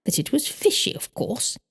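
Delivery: a touch of high-pitched exclaiming; stating the obvious with a lift. A narrator's reading. Mrs Cadabra to Trelawney, stating the obvious with a lift. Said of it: "she exclaimed, stating the obvious".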